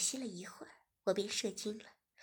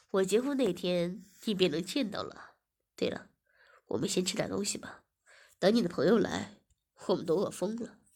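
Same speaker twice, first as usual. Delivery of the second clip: lower, louder confident man; male host voice; 4 semitones lower, 6 dB louder than usual. Acted. Host telling Ziying to wait for the wedding, lower. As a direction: speak low and assured. Host answers and suggests eating.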